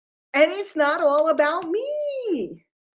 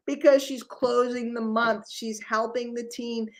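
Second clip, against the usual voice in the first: usual speaking voice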